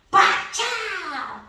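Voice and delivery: comic punch sound. Shown as a demonstration. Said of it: "ela fazia os barulhos dela".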